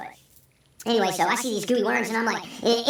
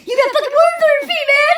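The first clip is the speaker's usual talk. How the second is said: high, loud panicked yell at a friend; freaked-out yell; 8 dB louder than usual. Retold or imitated. Tripping storyteller yelling at his friend, panicked high yell. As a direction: shriek it like you just saw worms on your feet. Tripping, sees worms on feet.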